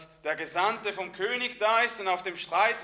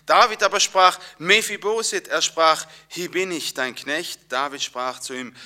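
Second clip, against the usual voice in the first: louder, solemn Bible reading; scripture reading; 7 dB louder than usual. Acted. Preacher reading the verse to his church, solemn and louder. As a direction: proclaim the verse to the congregation.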